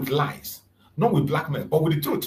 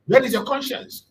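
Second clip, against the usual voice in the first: "Where" pitched high and pushed harder; louder accusing question; 8 semitones higher, 3 dB louder than usual. Retold or imitated. Kanu confronting the news agencies, louder accusing repeat. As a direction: repeat it more forcefully, rising. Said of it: "Asking them".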